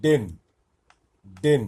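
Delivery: ডেন said with a clear short e vowel, loud; teacher stresses ডেন with an e vowel; short e sound. Shown as a demonstration. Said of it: "এর মতো, ইয়ের উচ্চারণটা এখানে এর মতো লাগছে".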